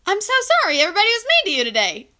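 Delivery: mock shocked sympathy. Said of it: "and I'm like".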